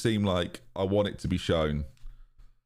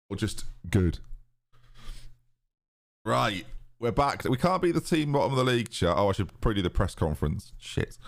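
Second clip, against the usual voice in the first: normal talking voice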